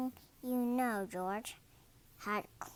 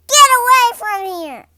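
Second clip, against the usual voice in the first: loud, high furious order; angry shout; 12 semitones higher, 22 dB louder than usual. A narrator's reading. Mister Herb chases George away from the counter, furious shout. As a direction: drive him off with a yell. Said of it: "Mister Herb was angry".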